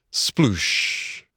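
drawn-out hushing splash; splash noise. A narrator's reading. Narrator as the boat tips over, whooshy splash sound. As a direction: imitate a body hitting water.

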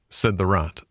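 usual speaking voice

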